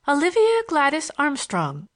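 high, playful schoolgirl announcement; merry, laughing. A narrator's reading. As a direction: sing out the full name merrily, pitched high. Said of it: "she said, laughing".

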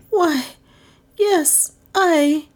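high, broken-off stammer of dismay; falter, caught out and horrified; utter dismay. A narrator's reading. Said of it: "in tones of utter dismay".

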